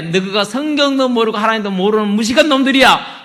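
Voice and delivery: Jesus scolding the learned men, loud rebuke; stern rebuke. Acted. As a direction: tell them off sharply.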